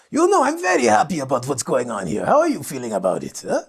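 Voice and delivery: lightly put-on refined voice; playfully grand, showing off words; hint of a mock-elegant gentleman; posh eloquent speaker. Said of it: "You're speaking like this".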